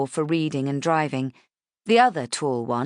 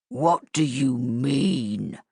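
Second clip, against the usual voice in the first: put-on low, slow monster growl for the kids; growly Gruffalo voice; 3 semitones lower, much slower than usual. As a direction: play the Gruffalo to make the girls giggle. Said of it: "put on his best growly Gruffalo voice".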